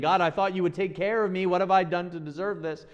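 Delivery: high, loud anguished plea; despairing cry to God. Pretend. Daniel imagined crying out to God, high anguished plea. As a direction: cry it up to heaven, betrayed.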